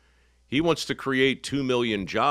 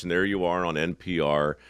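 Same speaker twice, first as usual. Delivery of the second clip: usual speaking voice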